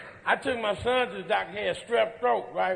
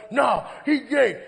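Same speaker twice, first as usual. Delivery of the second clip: mock shout; 2 semitones higher, slower than usual. Friend arguing, retold.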